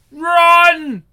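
loud, high panicked yell; shouted warning. Acted. Hero yells to the others to run. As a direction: scream at them to flee.

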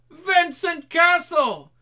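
reversed speech, shouted high; backwards talking. Acted. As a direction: yell it like a tape played backwards. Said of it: "doing the backwards talking".

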